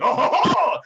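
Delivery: a hearty tavern keeper laughing; jolly laugh. Acted. Bartender bellowing a jolly laugh.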